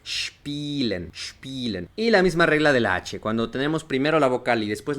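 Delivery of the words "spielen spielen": "spielen" starting with sh, long ee; SHPEE-len, softly and carefully; pronunciación alemana. Shown as a demonstration. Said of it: "el verbo jugar". ("spielen" con sh inicial e i larga)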